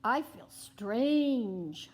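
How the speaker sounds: voicing Arthur. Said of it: "Arthur says".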